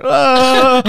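high, loud startled "Ah"; mock shocked gasp. Friend mocks the operator's shock, high and loud. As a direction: jolt back in fake shock.

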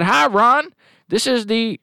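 high-pitched put-on Southern greeting; Southern accent. Disney boss phoning the governor, mock Southern accent. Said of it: "wait, that's the wrong accent".

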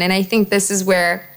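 ordinary talk

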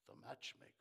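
breathy whispered reveal; hushed reveal. Acted. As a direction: drop to a secret whisper. Dramatic reveal of the name.

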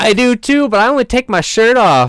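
high loud joking banter; rowdy drunk bro. Acted. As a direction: ham it up like drunk bros.

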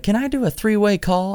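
high imitated girlfriend asking; mock girlfriend voice. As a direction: lift it way up like her.

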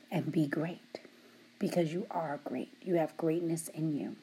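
soft whispered belief; tender hushed pep talk; whispered encouragement. Real, not acted.

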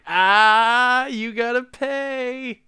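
high, slow, sing-song clerk voice; mock clerk. Pawn clerk telling the boyfriend he must pay, sing-song and slow. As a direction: draw it out, gloating. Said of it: "they're like".

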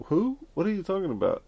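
sudden high, loud confused question; bewildered, jumping up in pitch; baffled. Retold or imitated. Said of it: "I'm like".

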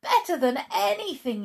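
very high, loud dog voice; eager yelp. A narrator's reading. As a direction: yelp with delight like a puppy. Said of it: "yelped the dog".

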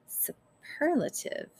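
breathless slow Superlative, voiceless and savoring; thought to herself. A narrator's reading. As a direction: Superlative whispered inwardly, drawn out slowly. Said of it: "Early thought".

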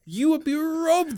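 high, slow mocking taunt; mocking singsong.

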